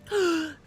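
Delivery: a quick delighted intake of breath; sharp excited gasp. Acted. Dog gasping at the bacon, sharp and delighted. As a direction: gasp like a dog spotting a treat.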